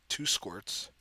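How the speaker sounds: ordinary delivery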